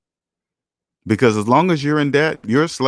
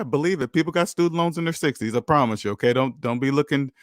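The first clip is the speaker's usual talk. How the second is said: usual speaking voice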